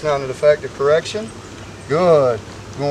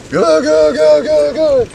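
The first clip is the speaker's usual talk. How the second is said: higher, eager string of goods; rapid praise; 11 semitones higher, slower than usual. Real, not acted. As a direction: keep the dog going with upbeat praise. Trainer praising dog mid-heel.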